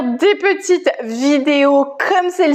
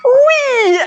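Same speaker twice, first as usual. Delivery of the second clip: excited cheer; 10 semitones higher, 4 dB louder than usual. Real, not acted. Cheering as exercise ends.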